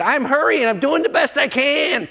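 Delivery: high, loud, put-upon whine; whiny excuse. Retold or imitated. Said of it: "Zacchaeus could have said".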